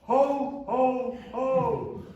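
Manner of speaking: high, loud ho ho ho called back; answering Santa call. Retold or imitated. Answering the bus driver's call.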